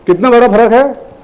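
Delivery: usual speaking voice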